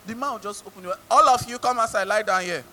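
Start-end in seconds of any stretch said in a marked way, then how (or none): 1.1-2.6 loud, commanding police order; forceful acted command; policeman's order. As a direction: order a crowd to the ground, loud. Acts policeman ordering people down.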